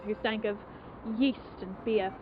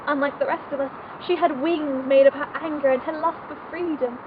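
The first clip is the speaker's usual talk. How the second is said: loud, raised, emotional outpouring; impassioned confession; 3 semitones higher, 5 dB louder than usual. Acted. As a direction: confess it fervently, voice lifted. Impassioned confession of jealousy and admiration.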